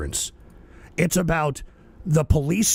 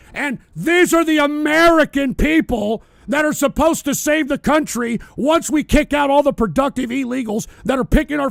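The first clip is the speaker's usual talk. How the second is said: high, loud furious yelling; furious shout; 8 semitones higher, 7 dB louder than usual. Real, not acted. Streamer yelling at viewers about employers, furious shout. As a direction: let the contempt explode.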